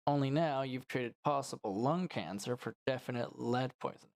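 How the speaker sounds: ordinary talk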